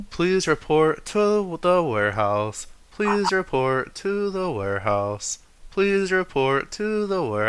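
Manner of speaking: high, slow, looping PA announcement; intercom announcement. Acted. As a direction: repeat it like a building intercom. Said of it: "the intercoms".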